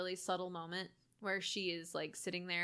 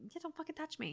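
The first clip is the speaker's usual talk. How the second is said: softer, pleased-with-herself line; smug quiet threat; 6 semitones higher, 9 dB quieter, faster than usual. Retold or imitated. Imitating the smug possessed girl, softly. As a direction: say it proudly under the breath. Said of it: "she's so happy to say it".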